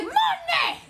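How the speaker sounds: high, loud snap of a name; teacher's sharp call. Retold or imitated. Strict teacher calling the student to the board, high and loud. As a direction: bark the kid's name like a fed-up teacher.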